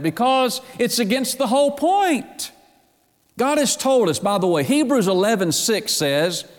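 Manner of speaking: sermon climbing toward fervor; building preacher fervor. Acted. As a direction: lift into full preaching. Rising preaching passage.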